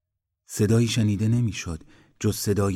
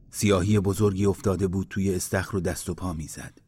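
ordinary delivery

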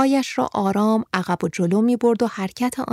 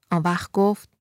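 ordinary delivery

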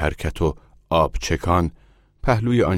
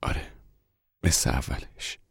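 softer, choked-back reply; a mother forcing a muffled yes; 3 semitones lower, 6 dB quieter than usual. A narrator's reading. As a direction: push the words out past tears, softer.

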